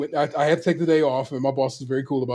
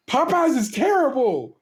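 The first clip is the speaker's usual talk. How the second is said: exasperated rant; 8 semitones higher than usual. Retold or imitated. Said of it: "I'm like".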